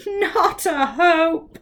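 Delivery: smiling. Acted. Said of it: "smiled the mouth".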